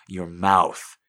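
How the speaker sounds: loud breaking-point shout. Acted. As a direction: blurt it out loud at last. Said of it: "she shouts at last".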